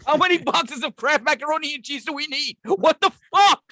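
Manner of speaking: high, loud mock rant at the pantry; mock-outraged partner cleaning the cabinets; mock exasperated wife. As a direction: play the fed-up spouse, voice up high. Mock wife ranting about Costco.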